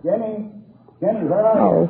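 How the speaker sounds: high-pitched call from a distance. Acted. Husband calling his wife from another room, calling out.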